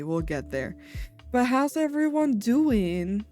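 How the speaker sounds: ordinary talk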